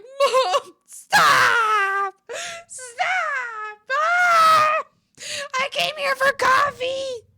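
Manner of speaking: high, loud crying shout at mom; screaming at mom to stop, near tears; panicked shout. Retold or imitated. Yelling at her mom over coffee, high and crying.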